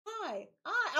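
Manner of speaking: high, chirpy hello; mock a cheery tourist greeting; imitated chirpy greeting; chirpy locals. Mimicking locals greeting in English.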